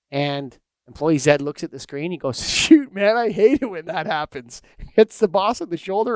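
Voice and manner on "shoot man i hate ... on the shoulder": casual sympathetic coworker. Retold or imitated. Employee Z voiced in an anecdote.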